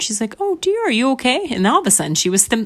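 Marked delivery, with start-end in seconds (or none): 0.4-1.5 high, cooing concern. Acted. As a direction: ask it like soothing a small creature. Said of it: "made the mom concerned".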